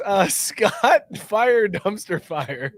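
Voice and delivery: ordinary delivery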